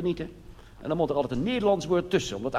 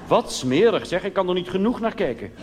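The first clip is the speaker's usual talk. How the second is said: mock disgust; 6 semitones higher, 5 dB louder than usual. Mock outrage at a picture.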